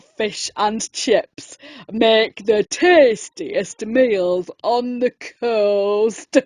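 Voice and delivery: low, slow, polite shark voice; a deep-voiced shark ordering politely. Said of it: "the shark spoke politely".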